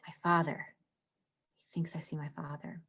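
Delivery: hushed, breathy whisper of the line. Shown as a demonstration. Teacher reading Hamlet to class, in a whisper. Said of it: "It stands out".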